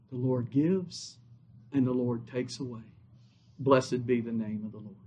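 low, soft, solemn recitation; hushed scripture quoting. Acted. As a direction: let Job's words settle softly, unhurried. Quotes Job's testimony in hushed tone.

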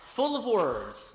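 each word drawn out, FULL leaned on; full of words, slowed right down; slow emphasis. Shown as a demonstration. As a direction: savour the phrase slowly. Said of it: "repeats phrase slowly for emphasis".

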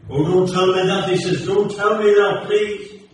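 put-on anxious young man pleading with the preacher, distressed; distressed exclaim. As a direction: beg him not to say it. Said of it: "exclaimed the distressed seeker".

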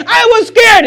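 high shouted excuse; mock scared cry. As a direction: yell the excuse like a child. Acting excuse at judgment.

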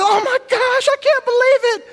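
shrill panicked nurse gasping at the nose; freaked-out nurse. Retold or imitated. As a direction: squeal it like a horrified nurse. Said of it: "like, freaked out".

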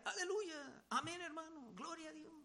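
imitating a hollow churchy greeting; mock pious churchgoer. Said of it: "A lo mejor antes él decía". (imitación de un creyente piadoso, más agudo y apagándose)

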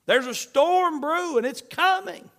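high, loud preaching cry; urgent warning. Real, not acted. Preacher warns of coming tribulation.

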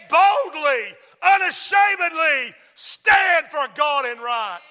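loud, drawn-out preacher's shout; full-force preaching. Real, not acted. Preacher rallying people to stand for God, full-force preaching. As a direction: drive each promise home with a loud punch.

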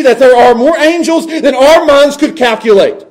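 high, building preacher's fervor; impassioned preaching. Real, not acted. Preacher to congregation on the returning angels, impassioned. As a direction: preach it out, swelling on the big words.

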